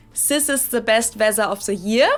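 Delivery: "Sis" for this, "weather" with a z for th; German accent, buzz the th in "weather" as z. Shown as a demonstration. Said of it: "T H with an S or Z sound".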